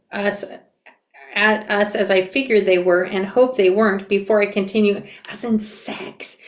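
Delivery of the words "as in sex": whispered, almost swallowed; embarrassed near-silent whisper; barely audible. Acted. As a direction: breathe the word out as if inhaling. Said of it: "comes out of my mouth so quietly".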